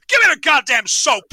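loud, high mock yell; hammed-up shouted demand; acted angry demand. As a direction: yell it like an exasperated film character. Acting out character demanding the soap.

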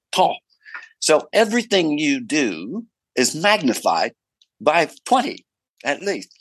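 an Aussie director giving friendly notes, half mimicked; Aussie director impression. Director coaching the actor about close-ups, in a light Aussie accent. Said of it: "he might have been an Aussie".

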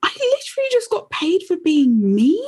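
excited voice-note announcement; excited news. Retold or imitated. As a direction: announce it thrilled and amazed. Voice note to family.

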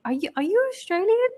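high-pitched puzzled questions; hammed-up curious questioning; puzzled guessing. Acting out strangers guessing her origin.

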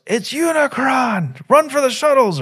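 high, drawn-out mock panic; panicked scream. Planet dweller imitated screaming at Unicron's arrival. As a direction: cry out in terror at the sky. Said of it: "the guy goes".

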